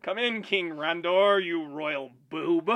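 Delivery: slow, drawn-out cartoon villain taunt; Skeletor taunt. Villain summoning King Randor, mocking drawl. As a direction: stretch the insult out, loud and hammy. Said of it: "He likes to say "you boob".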